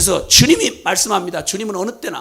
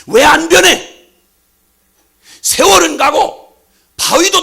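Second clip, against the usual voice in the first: the Lord loudly reproaching an unchanged believer; the Lord's rebuke; 2 semitones higher, 6 dB louder than usual. Acted. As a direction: hammer each question home loudly. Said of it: "라고 물으시는 주님의 책망".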